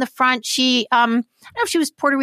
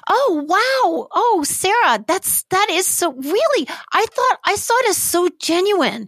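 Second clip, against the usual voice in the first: surprised; 8 semitones higher, slower than usual. Real, not acted. Surprised by Sarah's annoyance.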